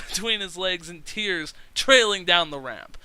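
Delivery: ordinary talk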